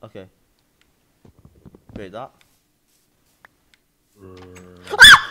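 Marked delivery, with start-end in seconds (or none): 4.2-5.3 sudden high scream; startled scream. Real, not acted. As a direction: yelp in fright. Jump scare by zombies.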